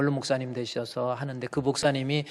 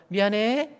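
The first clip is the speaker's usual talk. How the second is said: 미안해. pitched up high; wrong pitch; 8 semitones higher, slower than usual. Shown as a demonstration. Pastor demonstrating the wrong tone of apology. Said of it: "이거 또 틀리잖아".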